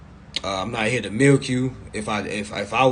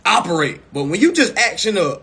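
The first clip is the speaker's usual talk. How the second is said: usual speaking voice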